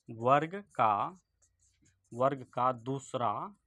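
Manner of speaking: slow, word-by-word dictation with pauses; drawn-out words, long gaps for writing. Shown as a demonstration. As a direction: spell it out phrase by phrase for note-takers. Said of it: "यहाँ लिख लीजिए".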